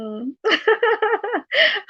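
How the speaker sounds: high-pitched giggling; rippling high laughter; real laugh. Real, not acted. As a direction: giggle high at your own joke. Laughs at her own joke.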